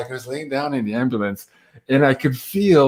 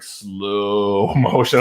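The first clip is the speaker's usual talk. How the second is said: stretched out in slow motion with a laugh; 4 semitones lower, much slower than usual. Shown as a demonstration. Describing the nurse's slow walk, drawn out slow. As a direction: drag the words out like a slowed film. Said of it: "like she's walking in".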